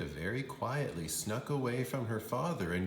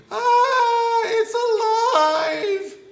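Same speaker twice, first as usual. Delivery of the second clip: very high, loud, slow scream; hammed-up fright; shrieking terror; 14 dB louder, much slower than usual. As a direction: scream in horror. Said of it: "The lady screamed".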